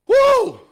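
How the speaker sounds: a very high, loud, celebrating whoop; joyful whoop. Real, not acted. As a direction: let out a joyful preacher's whoop. Preaching climax.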